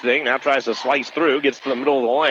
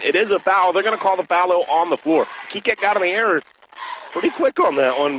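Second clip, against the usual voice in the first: higher, urgent foul call; excited call; 5 semitones higher than usual. Real, not acted. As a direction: sell the contact on the drive. Calling a foul on a drive.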